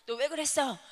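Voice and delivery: angry reproach. Shown as a demonstration. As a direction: snap at a child who misbehaved. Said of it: "이렇게 화나는 언어".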